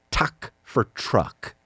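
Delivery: lightly voiced toddler mangling "Tuck", slow; mild toddler impression, "Tuck" without the r; imitating toddler. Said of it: "He says".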